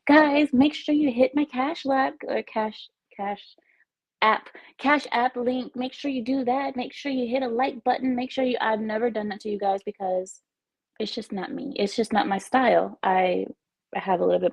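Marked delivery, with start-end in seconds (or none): 0.1-8.6 begging streamer. Retold or imitated. Streamer mocks other streamers begging chat for money, mock pushy. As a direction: ham up a pushy streamer asking for cash. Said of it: "I never say".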